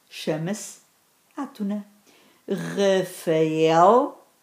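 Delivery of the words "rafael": Rafael drawn out, el rising and stronger; stress on final EL. Shown as a demonstration. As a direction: stretch Rafael, leaning on the last syllable el. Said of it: "é a sílaba tónica".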